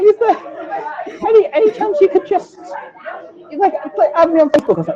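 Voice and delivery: high, pleading mock request; a high pleading mimic of a stranger; mimicking a stranger. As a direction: put on a nervous fan's voice. Mimics someone asking to add him.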